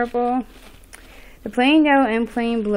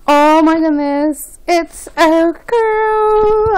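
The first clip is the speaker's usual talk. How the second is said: gasping surprise; 6 semitones higher, 6 dB louder, much slower than usual. Real, not acted. Learning it's a girl, a gasping high slow cry. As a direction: draw out the joy of the news.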